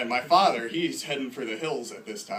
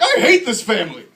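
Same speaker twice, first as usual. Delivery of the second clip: high, loud bratty shout; hammed-up family-row outburst; angry son; 8 semitones higher, 14 dB louder than usual. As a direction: yell it as a teenager throwing a fit. Acted son's outburst at father.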